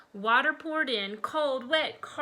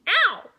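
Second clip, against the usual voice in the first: high, loud yelp of pain; sudden high-pitched "ow"; pained shriek; 12 semitones higher, 9 dB louder than usual. A narrator's reading. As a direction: yelp as if yanked by the ear. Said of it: "Chipper shrieked".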